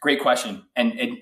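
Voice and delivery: usual speaking voice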